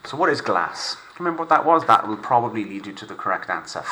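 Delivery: ordinary delivery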